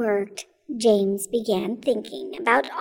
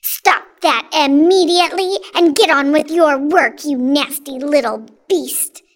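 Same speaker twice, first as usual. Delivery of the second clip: loud, scolding command; angry order; 3 semitones higher, 7 dB louder than usual. A narrator's reading. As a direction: order the child back to work, loudly. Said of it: "Aunt Sponge ordered".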